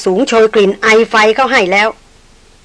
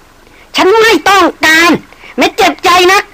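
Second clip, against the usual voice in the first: furious refusal; 10 semitones higher, slower than usual. Acted. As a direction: refuse him in a rage. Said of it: "อย่างเดือดดาล". (ปฏิเสธอย่างเดือดดาล)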